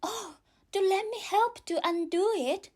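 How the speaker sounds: put-on high, anxious, eager girl. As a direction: offer help anxiously, like a girl. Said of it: "looking anxiously".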